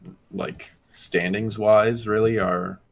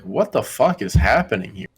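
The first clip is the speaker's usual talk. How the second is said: baffled outburst with big swooping pitch; retold disbelief; 4 semitones higher, 5 dB louder than usual. Viewer baffled by the team's new signings, incredulous. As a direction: recount it in mock bewilderment, pitch leaping. Retelling bewilderment at unknown players.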